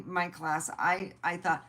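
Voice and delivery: normal talking voice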